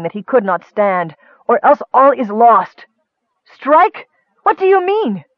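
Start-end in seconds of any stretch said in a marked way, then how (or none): 1.5-2.9 raised, desperate warning; higher, louder urgent tone; urgent order. A narrator's reading. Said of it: "ordered Raistlin, so weak with coughing". 3.4-5.2 high, startled questioning; shocked, higher-pitched outcry; alarmed. A narrator's reading. Sister alarmed by order to strike.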